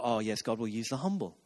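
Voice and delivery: low, soft, mock-reverent voice; put-on solemn approval; mock piety. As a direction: imitate a pious admirer, hushed and low. Mimics pious admirers of humility.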